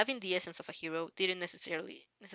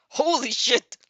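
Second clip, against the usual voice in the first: loud startled yell, pitched up; exclaimed; 4 semitones higher, 12 dB louder, slower than usual. A narrator's reading. All Might yelling in shock while plummeting through the air. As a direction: blurt it out in shock mid-fall. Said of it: "All Might exclaimed".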